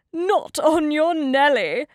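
lightly voiced wolf, loud refusal; refusing wolf. A narrator's reading. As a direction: turn the pig down loudly.

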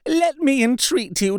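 high, desperate pleading through tears; frantic tearful entreaty. A narrator's reading. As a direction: beg for your freedom, voice cracking with tears. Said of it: "poured forth a torrent of entreaty".

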